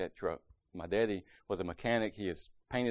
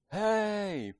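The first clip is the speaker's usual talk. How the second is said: retold yell; 12 semitones higher than usual. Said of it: "I just yelled".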